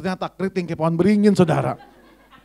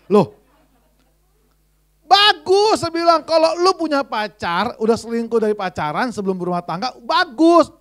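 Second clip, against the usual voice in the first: mock loud outburst; 6 semitones higher, 5 dB louder than usual. Pastor's loud retort to maid.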